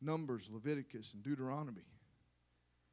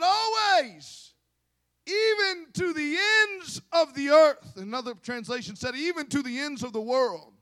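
high, loud, drawn-out proclamation; full-force preaching; 10 semitones higher, 12 dB louder than usual. Real, not acted. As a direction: belt out the promise to the congregation.

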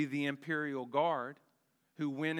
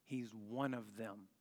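quiet, pointed, almost secretive; a low, knowing accusation; hushed accusation; 5 semitones lower, 10 dB quieter than usual. Acted. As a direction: lean in and murmur the charge. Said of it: "The girl says".

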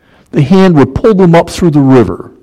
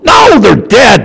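emphatic shout; 8 semitones higher, slower than usual. Real, not acted. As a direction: shout the point home. Preacher correcting the congregation.

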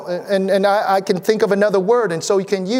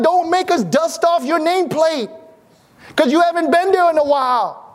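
high-pitched, lively pulpit exhortation; rousing preaching; 7 semitones higher than usual. Real, not acted. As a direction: lift the congregation up, inviting them in. Preaching to the congregation.

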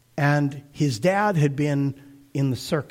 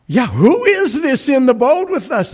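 higher, louder, astonished question; amazed disciples; 8 semitones higher, 7 dB louder than usual. Retold or imitated. Disciples in the boat wondering about Jesus, astonished. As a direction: voice the stunned disciples after the storm. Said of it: "they're like".

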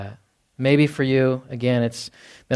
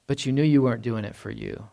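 ordinary delivery

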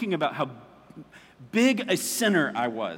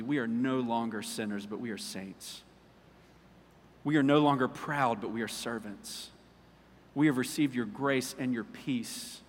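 prayer. Acted. Leads closing prayer.

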